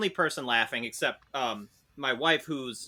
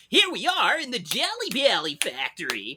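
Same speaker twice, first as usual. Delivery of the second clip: put-on high, bouncy kids-show host; high cheery tour guide for kids; enthusiastic tour guide voice; 10 semitones higher than usual. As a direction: pitch it way up like a Sesame Street character. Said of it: "he was using his voice where he's like".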